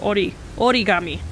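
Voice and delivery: origami said the Japanese way, "odigami"; Japanese pronunciation. Shown as a demonstration. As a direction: origami with a light tapped r, o-ri-ga-mi. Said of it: "That's how the Japanese pronounce it".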